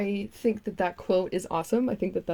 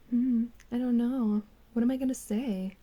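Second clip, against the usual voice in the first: mock pondering guy; 6 semitones higher than usual. For the video thumbnail, a mock pondering creator voice. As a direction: imitate him stuck for words. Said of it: "Just kidding, it's more like".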